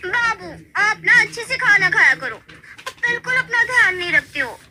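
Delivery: high, loud fussing wife; fond scolding. Acted. Wife scolds husband fondly. (पत्नी की ऊँची प्यार भरी डाँट)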